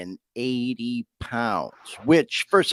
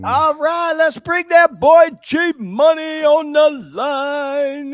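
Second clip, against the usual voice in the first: high, loud, slowed-down hype to bring a caller on; excited welcome; 12 semitones higher, 7 dB louder, slower than usual. Real, not acted. Host bringing his son onto the call, excited and high. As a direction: announce your son like a star guest.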